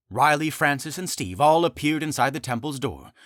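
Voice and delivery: usual speaking voice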